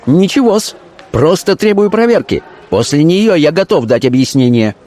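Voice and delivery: high-pitched insistent answer; defiant reply. A narrator's reading. Officer answering the accused dealer, defiant.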